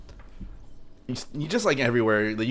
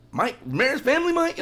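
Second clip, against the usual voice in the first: surprised friend; 4 dB louder than usual. Retold or imitated.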